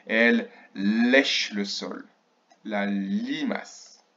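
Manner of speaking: slow, with long L in lèche and limace; L étirés. Shown as a demonstration. Teacher stretches L sounds, slug description. (lentement, les L de « lèche » et « limace » prolongés)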